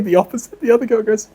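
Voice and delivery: ordinary delivery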